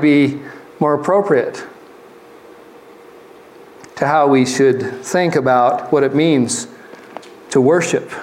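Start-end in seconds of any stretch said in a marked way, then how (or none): none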